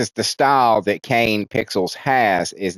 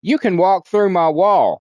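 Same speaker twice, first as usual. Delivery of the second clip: lightly voiced character; 7 semitones higher than usual. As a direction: lightly act out the inviting line. Character explaining the magic wall.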